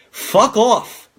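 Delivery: angry. Real, not acted. Ranting at door-to-door salesmen, sharp angry snap. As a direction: tell them off sharply.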